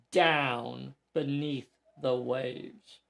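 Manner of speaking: usual speaking voice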